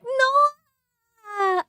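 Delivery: high mock squeal of protest; imitating a shriek. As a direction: squeal it like a streamer caught out. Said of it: "she was like".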